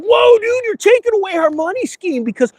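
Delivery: high, shouted whoa dude; hammed-up panic over losing the money scheme; mock Democrat outrage. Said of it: "They're like".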